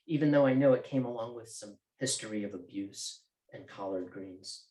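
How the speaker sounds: usual speaking voice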